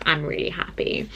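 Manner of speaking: soft unvoiced whisper; whispered happiness. Real, not acted. Telling viewers about her writing, whispered. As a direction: breathe it out contentedly.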